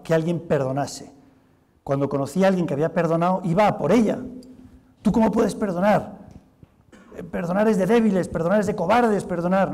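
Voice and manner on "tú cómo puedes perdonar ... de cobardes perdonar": raised, louder, scornful attack on forgiveness; scornful challenge. Retold or imitated. Bitter woman scorning a forgiver, raised and contemptuous. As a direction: confront them with contempt, pitch up and loud.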